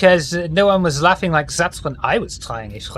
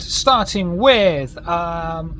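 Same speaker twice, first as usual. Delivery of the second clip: usual speaking voice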